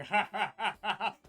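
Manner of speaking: background laughter. A narrator's reading. Game master voices a Cambion in the background, laughing. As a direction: cackle of a bar onlooker. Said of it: "in the background starts laughing".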